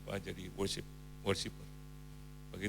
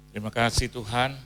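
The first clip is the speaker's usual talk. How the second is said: closing prayer; 3 semitones lower than usual. Real, not acted. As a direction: lead the congregation in prayer.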